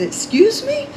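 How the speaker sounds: high-pitched indignant question, rising at the end; indignant challenge. Retold or imitated. Traveler retelling a reply to the woman in her face, indignant. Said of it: "Like that".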